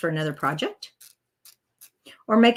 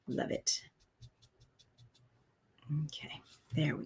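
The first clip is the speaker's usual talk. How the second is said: whispered to self; 4 semitones lower, 14 dB quieter than usual. Real, not acted. Quietly finishing the inking.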